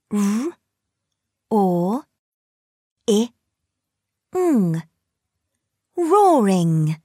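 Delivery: "R. Or. I. Ng." said as separate sounds, then blended into "Roaring"; sounding out words. Shown as a demonstration. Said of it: "Blend the sounds".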